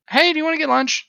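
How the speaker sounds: high bright invitation; sudden cheery offer. Acted. Insult fight ending in lunch invite, high cheery offer. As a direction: flip to sweet and friendly.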